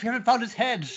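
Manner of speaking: put-on lifted high into a boyish brother voice; anxious sibling pleading high. Brother voiced in a retold scene.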